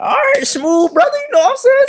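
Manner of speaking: very high squeaky falsetto reply; mock eager agreement. Nervously thanking the boyfriend, mock eager falsetto. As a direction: squeak out your grateful agreement.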